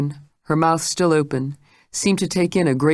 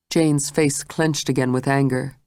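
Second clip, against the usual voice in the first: usual speaking voice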